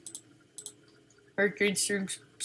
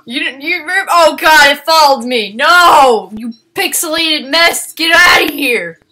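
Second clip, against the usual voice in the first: loud, higher shouts at the monster; angry yelling; 6 semitones higher, 12 dB louder than usual. Real, not acted. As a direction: drive it off with shouts.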